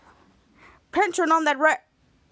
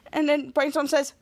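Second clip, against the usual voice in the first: plain reading voice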